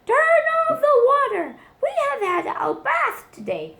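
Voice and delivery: high, loud indignant yell; neighbours cry out. A narrator's reading. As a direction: shout across at the mouse. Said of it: "people next door cried".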